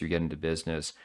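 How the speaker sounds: usual speaking voice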